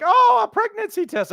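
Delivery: high, bright burst of excitement; a parent thrilled by a pregnancy test; excited. Retold or imitated. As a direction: pop up high with delight. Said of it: "you're initially excited and you're like".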